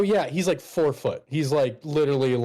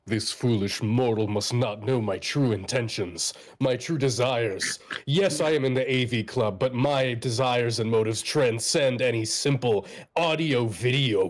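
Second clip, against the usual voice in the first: inner villain voice; 10 semitones lower than usual. Acted. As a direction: growl it like a scheming evil mastermind. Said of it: "monologuing in his, like, in the voice".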